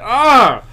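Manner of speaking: high loud "Ah!" of shock; startled yell. Retold or imitated. Watching a stabbing in Dead Space, a loud startled yell. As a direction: yelp in horror. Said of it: "I'm like".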